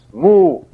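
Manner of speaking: imitated moo. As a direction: call out like the lost cow. Cow mooing heard in the barn.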